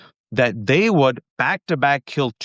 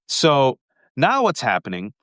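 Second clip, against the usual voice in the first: normal talking voice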